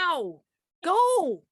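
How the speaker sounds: loud, high urgent yell. Real, not acted. Clue-giver to guessing partner in a timed game, yelling. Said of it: "He just kept yelling".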